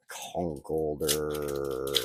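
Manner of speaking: Conkeldurr stretched out very slowly; slow drawn out. Shown as a demonstration. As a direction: savor each syllable of Conkeldurr. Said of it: "what a weird name".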